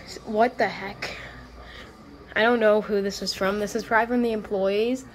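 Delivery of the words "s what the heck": normal talking voice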